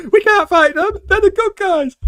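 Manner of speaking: very high, loud laughing panic; laughing hammed-up panic; mock panic shout. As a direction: wail it like a panicked soldier. Characters panicking, imagined.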